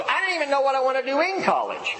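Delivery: high, quick exasperated student; imitated exasperation. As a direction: act out a daughter throwing up her hands. Acted exasperated daughter about her plans.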